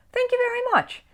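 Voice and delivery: very high, polite little bear; high Paddington voice. A narrator's reading. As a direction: lift it way up, thanking sweetly. Paddington thanks the porter.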